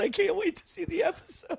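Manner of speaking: high, squeaky laughing quote; girl quoted through laughter. Retold or imitated. Girl writing to her mocker, quoted through laughter. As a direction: quote her while cracking up. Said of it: "she's writing to him going".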